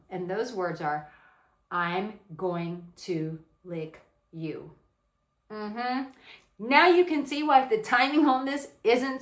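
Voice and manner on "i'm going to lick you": spaced out word by word; slow, deliberate delivery. Shown as a demonstration. Teaching viewers the five words, slow and deliberate. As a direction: say it very slowly, with weight. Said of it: "teach you how to say them".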